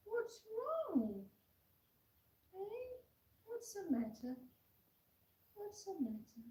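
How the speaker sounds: high, concerned cooing that drops back down; soothing pet talk. Real, not acted. Soothing the fussing puppy.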